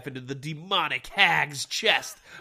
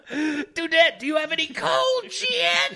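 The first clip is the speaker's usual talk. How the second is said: high laughing shout; laughing high call; 14 semitones higher, 5 dB louder, slower than usual. Pretend. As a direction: squeal it out through laughter.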